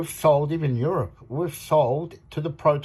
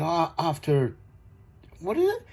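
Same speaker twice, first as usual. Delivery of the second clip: quoting the guy; 6 semitones higher than usual. Retold or imitated. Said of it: "He said".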